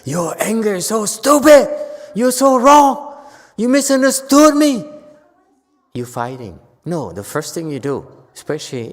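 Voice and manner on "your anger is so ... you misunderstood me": loud, high, furious shouting back; angry yelling. Shown as a demonstration. Teacher demonstrates yelling back at angry people. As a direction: yell it as the wrong way to answer. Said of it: "You cannot ignore it and say".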